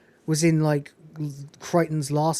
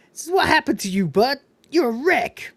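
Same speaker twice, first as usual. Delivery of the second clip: a higher, mocking character voice; put-on high voice; 9 semitones higher, 4 dB louder than usual. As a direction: tease him in a squeaky voice. Mock insult in a put-on voice.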